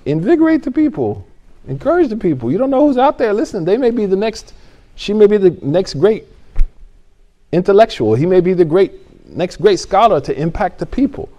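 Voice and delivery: high, loud, fired-up urging; rally the teachers, full of fire; impassioned plea, voice high and strong; passionate appeal. Real, not acted.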